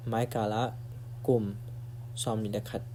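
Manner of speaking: normal talking voice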